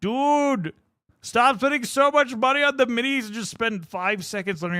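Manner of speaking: exasperated plea. Retold or imitated. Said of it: "I'm like, Dude".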